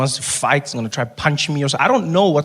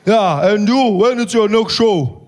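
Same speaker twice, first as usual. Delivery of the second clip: slow, louder, higher put-on voice; Malema impression; 5 semitones higher, 4 dB louder, slower than usual. Malema chatting with Trevor at dinner, slow swaggering voice. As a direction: drawl it with easy swagger. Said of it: "He says".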